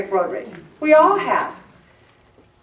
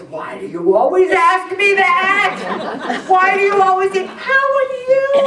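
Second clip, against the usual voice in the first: high, loud mocking voice with a laugh; mocking mother; 10 semitones higher, 6 dB louder than usual. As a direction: mimic mom mocking her. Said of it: "she started mocking me".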